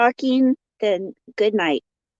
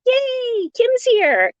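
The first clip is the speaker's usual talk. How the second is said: excited greeting; 13 semitones higher, much slower than usual. Real, not acted. Greeting a friend who just arrived.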